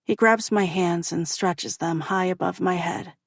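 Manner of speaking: ordinary delivery, nothing marked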